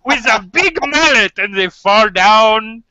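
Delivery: put-on gleeful sales pitch in hammy German accent; cartoony German accent. Doctor explaining the mallet cure, cartoony German accent. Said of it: "the most cartoony German accent".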